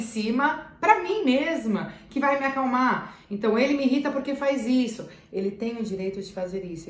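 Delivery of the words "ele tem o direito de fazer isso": softer and lower, like a mantra to herself; calm yourself with the phrase; mantra spoken softly. Shown as a demonstration. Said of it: "como um mantra". (mantra dito baixinho, para si mesma)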